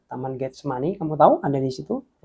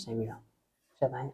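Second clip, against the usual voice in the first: ordinary delivery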